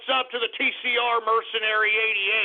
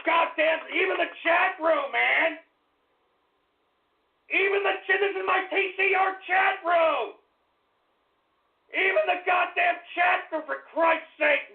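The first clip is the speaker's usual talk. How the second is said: higher, raised exasperated outburst; exasperated rant; 7 semitones higher than usual. Real, not acted. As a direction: vent in disbelief at the chat. Exasperated at his chat room.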